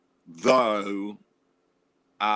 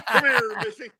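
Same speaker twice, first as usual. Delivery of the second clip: higher, louder mock seducer calling out; hammed-up flirt beckoning; flirty come-on; 10 semitones higher, 6 dB louder than usual. Playful flirt voice.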